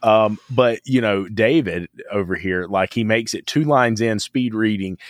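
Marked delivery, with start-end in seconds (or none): none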